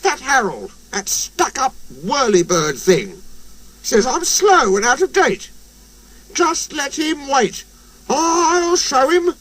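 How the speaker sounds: high, slowing, huffy rant; indignant high engine voice. A narrator's reading. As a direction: fume about the bully. Percy fuming about Harold.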